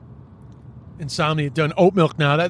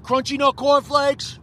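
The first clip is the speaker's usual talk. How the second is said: high, drawn-out call through a window; acted order at a service window; 7 semitones higher, slower than usual. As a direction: pitch it up and stretch each word to get through. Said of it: "so hard to order at the window".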